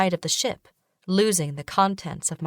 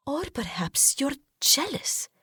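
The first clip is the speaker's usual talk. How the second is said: slow, sly whisper, higher; hushed accusation; 8 semitones higher, 4 dB quieter than usual. Acted. Wife whispering a jealous taunt at her husband. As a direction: breathe the accusation softly.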